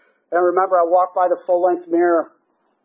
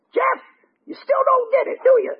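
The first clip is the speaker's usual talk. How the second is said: very high-pitched scolding impression; Rusty. Old-timer confronting a relapsing member, high-pitched scolding. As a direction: put on a squeaky, needling old-timer voice. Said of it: "he said it like this".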